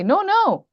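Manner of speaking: "No, no" thrown up high in pitch; emphatic repetition. Shown as a demonstration. Teacher gives an English example of repetition, a high emphatic "No, no". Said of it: "we keep repeating these words".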